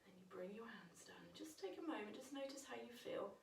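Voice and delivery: hushed whisper, very soft. Shown as a demonstration. Teacher settling children after the humming breath, whispering. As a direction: whisper gently to settle the class.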